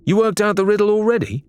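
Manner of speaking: high, quick doubtful question; dubious. A narrator's reading. Larinna doubts Faisal solved the riddle, high and dubious. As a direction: sound unconvinced. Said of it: "Larinna asked, dubiously".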